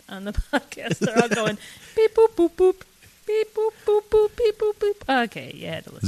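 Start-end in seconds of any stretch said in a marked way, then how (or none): 2.0-5.2 high electronic beep-boop noises; robotic beeps. Retold or imitated. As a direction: imitate a cheap keyboard. Imitating electronic drum sounds.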